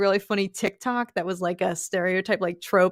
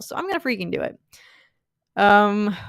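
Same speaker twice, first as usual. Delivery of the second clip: ordinary talk, nothing marked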